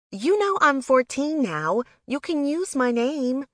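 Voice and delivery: put-on high, loud teenage protest; protesting teen sister. A narrator's reading. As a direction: object like a kid sister insisting she's grown. Teen sister objects to her nickname.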